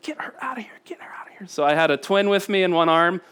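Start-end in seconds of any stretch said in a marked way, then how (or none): 0.0-1.5 hissed whisper, much softer; urgent whisper. Retold or imitated. Wife whispering to husband during a phone call. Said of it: "Lisa's like".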